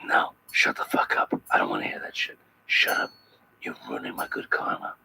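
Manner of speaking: whispered annoyed archangel hushing crying people; soft, low, grumpy divine shush; irritated angel. Retold or imitated. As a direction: hiss it low, fed up with the noise. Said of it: "he's pretty much like".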